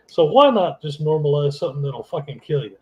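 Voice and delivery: ordinary delivery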